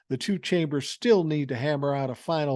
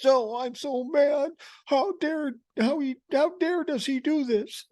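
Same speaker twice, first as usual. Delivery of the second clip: mock angry whine; 12 semitones higher than usual. Mocking Tuberville's tantrum for viewers, high whiny voice. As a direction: squeal it like a sulking child, pitched way up. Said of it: "Tommy's angry about this".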